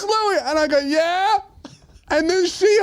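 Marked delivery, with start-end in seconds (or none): 0.0-0.4 high-pitched mock woman's call; high sing-out of a name; imitated woman calling. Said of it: "where she goes". 0.8-1.4 high, rising mock call; called-back answer. Acting out his called-back answer.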